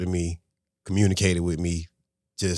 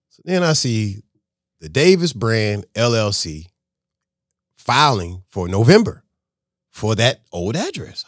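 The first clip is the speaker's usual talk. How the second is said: ordinary talk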